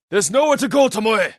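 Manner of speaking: loud cornering call. Acted. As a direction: cut off her escape with your voice. Cornering the fleeing archer.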